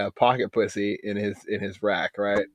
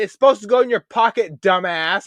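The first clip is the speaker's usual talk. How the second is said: higher, louder teasing jab; mock ribbing; 9 semitones higher, 5 dB louder than usual. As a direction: rib him with a grin. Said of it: "And I said".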